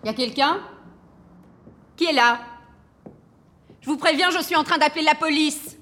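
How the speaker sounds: high, alarmed calling out; frightened. Acted. Club owner hearing someone, threatening police, frightened. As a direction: challenge an unseen intruder in fear. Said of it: "tu m'as foutu une de ces trouilles".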